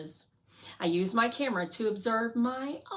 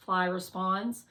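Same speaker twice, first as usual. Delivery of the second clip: usual speaking voice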